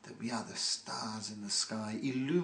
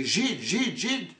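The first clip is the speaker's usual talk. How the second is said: louder and higher, eager chant of the slur; excited taunting; 7 semitones higher, 6 dB louder, slower than usual. Retold or imitated. Hooligans run over expecting money, excited higher calls. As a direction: call it out eagerly for a reward. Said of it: "they were excited".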